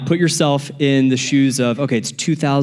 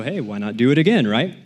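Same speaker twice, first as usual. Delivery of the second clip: normal talking voice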